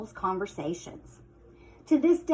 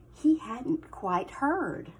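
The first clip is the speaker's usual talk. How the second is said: ordinary delivery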